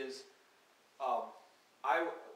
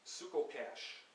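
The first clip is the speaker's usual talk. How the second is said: reading poem words; 2 semitones higher, 3 dB louder than usual. Acted. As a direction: let each word hang alone. Reads invented poem words aloud.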